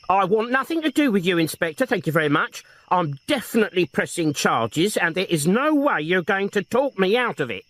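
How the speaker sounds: high, prim, indignant refusal; old woman refusing the inspector, high and prim. A narrator's reading. A hint of a high, indignant old woman.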